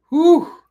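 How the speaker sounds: very high, loud "whew" of release; loud high whoop. Real, not acted. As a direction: let out a huge whoop. Release after emotional win.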